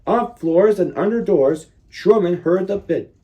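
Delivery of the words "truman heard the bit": ordinary delivery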